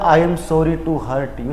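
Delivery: "I am sorry to hurt you" said very slowly; teacher quoting a student's wrong apology slowly; slow quoted wrong line. Retold or imitated. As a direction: spell out the mistake slowly. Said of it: "अगर आपने क्या बोल दिया".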